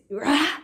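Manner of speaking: loud imitated roar of rage; retold roar. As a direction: let out his wordless roar again. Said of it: "he's screaming".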